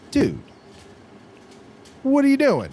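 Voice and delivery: calling out a guy, pitch jumping up; mock alarmed call across the lot; retold call-out. Telling off a man leaning on a car, mock alarm.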